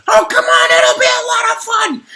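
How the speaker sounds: very high, loud wheedling; high beetle pressuring the girl; pushy beetle. Acted. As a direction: pester her, loud and high.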